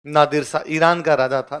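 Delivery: ordinary delivery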